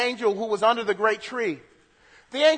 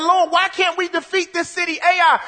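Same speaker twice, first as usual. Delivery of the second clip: Joshua crying out; 7 semitones higher, 4 dB louder than usual. Acted. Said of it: "This is like Joshua said".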